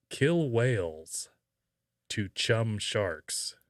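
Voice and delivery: ordinary talk, nothing marked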